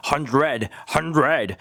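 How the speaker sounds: "hundred" with a hugely overdone, drawn-out "RRED"; exaggerated R. Shown as a demonstration. Teacher joking you'd swallow your tongue, exaggerated R. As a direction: chew heavily on the R in "hundred". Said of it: "이렇게 하면은 진짜 혀를 먹어서".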